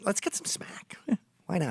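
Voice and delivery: sneaky tempting whisper; recovering addict whispering about scoring. Retold or imitated. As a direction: whisper it like a guilty secret. Relapsing addict tempts himself.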